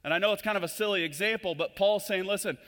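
loud, high, driving sermon voice; preaching at full force. Acted. Preacher urging the church to live their identity, full-force preaching. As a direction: pound the point home to the congregation.

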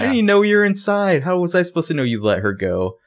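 high, loud mock outrage; indignant outburst. Spencer firing back at Jason's accusation, loud and indignant. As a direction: throw the question back at him, high and sharp.

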